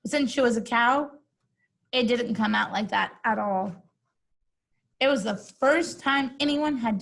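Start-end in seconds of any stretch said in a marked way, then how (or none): none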